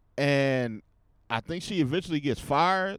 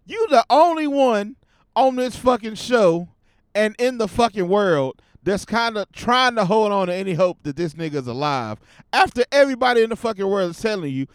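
ordinary talk

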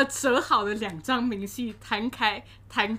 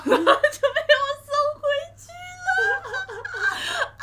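laughing squeal; 6 dB louder than usual. Real, not acted. Telling how she sent him back to his seat, laughing squeal.

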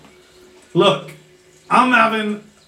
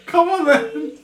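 imitating a companion; 7 semitones higher than usual. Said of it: "and you go".

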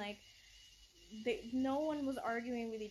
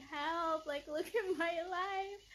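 higher, louder mock cry for help, laughing through it; mock plea; 6 semitones higher, 4 dB louder, slower than usual. Mocking the mopping wife's video, laughing plea. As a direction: parody her plea with a laugh.

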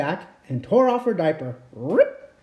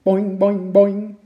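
high, louder boing on each bounce; bouncy boing; 3 semitones higher, 6 dB louder, slower than usual. A narrator's reading. Storyteller bouncing the pogo stick noise for kids.

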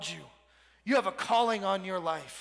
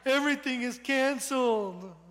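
put-on crying little girl; 3 semitones higher than usual. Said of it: "little girl ... that was just crying".

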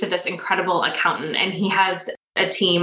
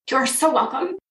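getting emotional; 4 semitones higher than usual. Real, not acted. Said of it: "I'm gonna get emotional about it".

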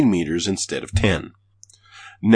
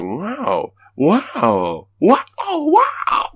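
rising amazed wows, getting higher; 12 semitones higher, 4 dB louder, much slower than usual. Retold or imitated. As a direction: build up the wows in awe. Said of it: "'Cause I was like".

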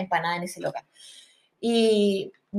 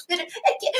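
high, softer words lost in mock giggles; imitating laughing speech; 8 semitones higher, 6 dB quieter, much faster than usual. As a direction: try to explain through pretend laughter. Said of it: "y va, y se reía". (imitando hablar muerta de risa)

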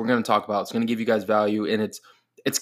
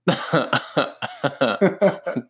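real, drawn-out odd laugh; 3 semitones higher than usual. Real, not acted. Co-host cracking up at the clerk impression, odd laugh. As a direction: let out a strange run of laughter. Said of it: "Why did I laugh like that?".

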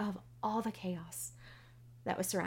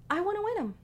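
high, louder pleading to God; 12 semitones higher, 4 dB louder, much faster than usual. Retold or imitated. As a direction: beg for the tickets, voice jumping up. Pleading prayer for tickets.

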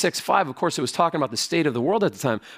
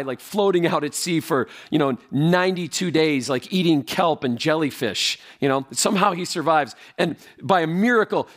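ordinary delivery

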